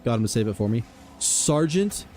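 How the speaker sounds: normal talking voice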